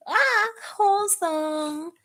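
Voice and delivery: high, drawn-out delighted squeal; squealed fangirl gushing. Real, not acted. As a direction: coo it high and long, overcome by sweetness. Gushes over a tender scene.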